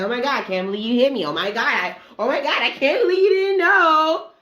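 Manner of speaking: high, loud whiny mock voice of the man; mocking his whine. Mocking the man she kicked, high whiny shock. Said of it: "He's like".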